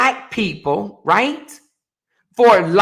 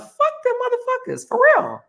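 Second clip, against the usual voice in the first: sudden much higher pitched dismissal; a shrill spike of contempt; defiant outburst. Real, not acted. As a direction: fling it off high and scornful.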